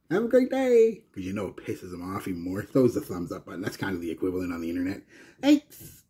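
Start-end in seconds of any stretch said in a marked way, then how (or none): 0.1-1.0 very high, loud singsong politeness; fake smiling cheer. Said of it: "it's like smiling at somebody". 5.4-6.0 very high, loud fake-sweet chirp; mock cheery thanks. Mock cheerful reply to online haters.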